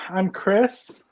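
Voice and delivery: usual speaking voice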